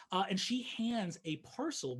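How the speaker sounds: normal talking voice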